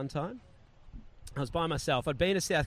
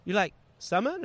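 higher, questioning imitation of Korean-accented English; tentative Korean-accented phone voice, raised pitch; halting Korean-accented English; 6 semitones higher, 5 dB louder than usual. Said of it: "a little bit of English".

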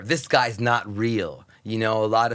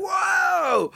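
high shouted whoa, taken aback; startled whoa; 3 dB louder than usual. Retold or imitated. People meeting the hyper fan react with a shouted whoa. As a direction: leap up in pitch on a startled whoa.

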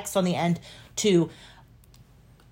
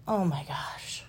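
exasperated whisper; 3 semitones lower, 11 dB quieter, slower than usual. Real, not acted. Disbelief at cohost's answer.

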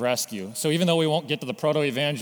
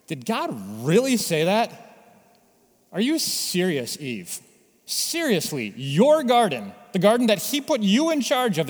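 mock disbelief, pitch climbing higher on the garden lines; sarcasm; 4 semitones higher, 3 dB louder than usual. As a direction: sneer it like a coy, sarcastic tempter. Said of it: "like, sarcasm".

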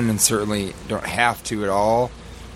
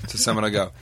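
ordinary talk

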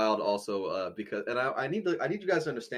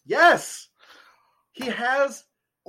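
excited yes; 11 semitones higher, 8 dB louder, much slower than usual. Real, not acted. Answering the prison paper guess.

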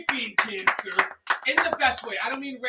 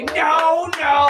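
imitating sister; 5 semitones higher, 8 dB louder than usual. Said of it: "She would say".

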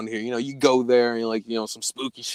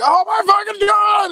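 high, loud shriek of fright; terrified shout; 7 dB louder than usual. Retold or imitated. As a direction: scream as the banging starts. Retold scream at trunk noise.

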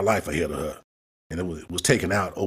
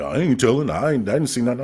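mocking witness; 5 semitones higher than usual.

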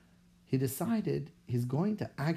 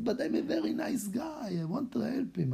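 mock sweet plea; 8 semitones higher than usual. Pleading again with secretary.

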